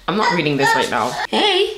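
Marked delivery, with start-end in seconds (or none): 1.3-1.8 much higher sing-song hello. Real, not acted. Mother greeting her baby boy in a much higher baby-talk voice.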